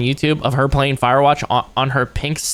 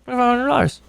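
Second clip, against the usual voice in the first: high put-on voice; 9 semitones higher, faster than usual. Repeats price in high voice.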